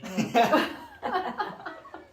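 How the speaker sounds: high laughing burst; a real helpless laugh; burst of laughter. Real, not acted. After she hangs up.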